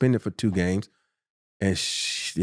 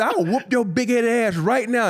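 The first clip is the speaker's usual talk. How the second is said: high, slow, drawn-out threat; slow mock menace; retold threat; 11 semitones higher than usual. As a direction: square up in the locker room. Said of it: "I'm hot".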